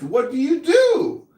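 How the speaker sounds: normal talking voice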